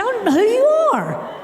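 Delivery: high-pitched imitation of an outraged dad; hammed-up indignant yelp; indignant denial. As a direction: protest in mock outrage, voice shooting up. Father's retold outburst at woman.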